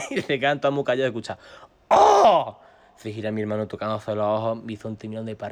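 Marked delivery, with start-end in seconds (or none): 1.9-2.6 high, mock-horrified cry; imitated gasp of shock, shouted high; loud shocked "oh". Onlookers' reaction to the hit.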